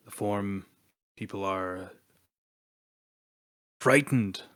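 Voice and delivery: low, gravelly god of the reef. Acted. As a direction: rumble it out like an ancient sea god. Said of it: "in a much deeper, uh, kind of gravelly voice now".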